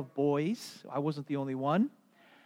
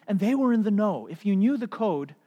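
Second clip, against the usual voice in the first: usual speaking voice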